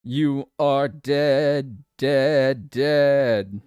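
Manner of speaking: very slow, drawn-out pronouncement; mock death verdict. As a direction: stretch every word like a doom-laden game master. Mock game master declares a death.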